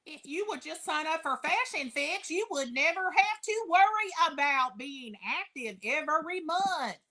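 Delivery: put-on mild West Kentucky accent sales pitch; imitating West Kentucky accent. Imitating an upline pushing Fashion Fix, mild West Kentucky accent. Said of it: "that's my deep West Kentucky accent".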